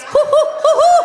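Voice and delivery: high hooting like a dumb owl; owl hoots. Retold or imitated. Wife mimicking husband's owl eyes, high hoots. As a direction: hoot high like a silly owl. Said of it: "big dumb owl eyes".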